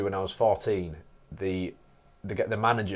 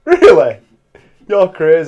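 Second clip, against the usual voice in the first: loud, high disbelief; loud shocked tease at a friend; mock disbelief; 8 semitones higher, 16 dB louder, slower than usual. Real, not acted. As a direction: react as if that's insane. Reacting to burnt steak choice.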